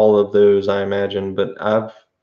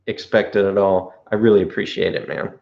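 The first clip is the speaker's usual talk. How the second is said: ordinary talk, nothing marked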